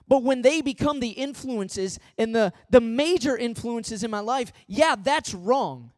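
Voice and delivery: a full-voiced sermon peak; preaching at full force. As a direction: drive it home to the congregation. Preacher hammers the point.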